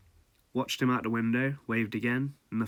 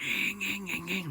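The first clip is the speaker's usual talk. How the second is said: high nasal ing ing of a rickety train; screeching train noise; 10 semitones higher than usual. Retold or imitated. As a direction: mimic the train's high squeal. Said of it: "making a noise as it come up".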